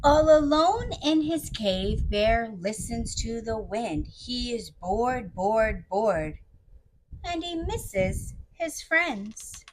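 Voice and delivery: put-on slow, soft read-aloud to children; unhurried, hushed storytelling; story narration. A narrator's reading. Storyteller describing lonely Bear in his cave, slow gentle narration. Reads the picture book aloud.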